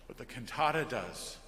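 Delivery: ordinary talk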